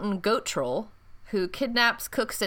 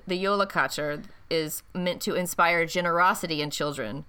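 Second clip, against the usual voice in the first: ordinary delivery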